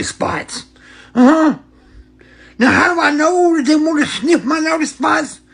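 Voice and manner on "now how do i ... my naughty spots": mock outraged question. Rhetorical build in comedy bit.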